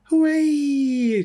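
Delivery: high, loud cheer. A narrator's reading. Children cheering at the teacher's news, high and loud. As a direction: whoop it up like a class of kids. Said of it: "cried the children".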